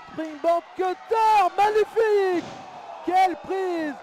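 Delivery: high, loud, stretched-out hype call; excited shout. Acted. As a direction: scream the finisher like ringside. Finisher hits in wrestling call.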